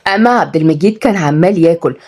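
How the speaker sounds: ordinary delivery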